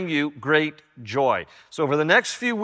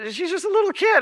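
amused exclamation; faster than usual. Real, not acted. Amused aside in retold exchange.

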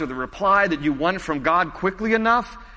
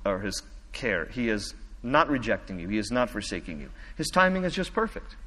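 tender reassurance; 6 semitones lower, 6 dB quieter than usual. Real, not acted. Pastor comforting those who are waiting.

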